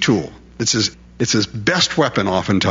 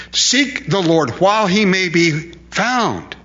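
solemn scripture reading; 6 semitones higher, slower than usual. Acted.

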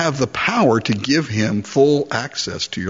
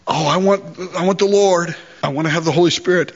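higher, quick, eager imitation of a churchgoer; mock eager churchgoer; 5 semitones higher, faster than usual. Preacher mimics a believer who only wants blessings, mock eager. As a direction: lightly play the shallow believer who wants the Lord. Mocking a half-committed churchgoer.

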